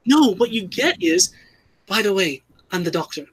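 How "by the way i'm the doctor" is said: tossed-off, softer mock of the line; offhand mock line. Mocking Jodie's Doctor announcing herself, offhand and flat. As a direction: drop it casually, no weight, trailing off. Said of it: "Even the way she said it".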